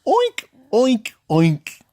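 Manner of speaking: high-pitched oinking piggy; playful high oink noises. Acted. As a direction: squeal out short oinks like a pig. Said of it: "the piggies say".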